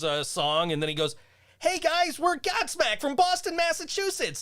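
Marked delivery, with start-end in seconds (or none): 1.6-4.4 much higher, small chirpy stage patter; tiny singer greeting the crowd way up high. Retold or imitated. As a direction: announce the band in a squeaky-high voice. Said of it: "that high-pitched voice".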